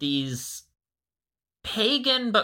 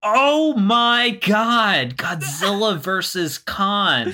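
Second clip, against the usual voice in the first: delighted awe; 5 semitones higher, slower than usual. Real, not acted. Echoing the matchup title.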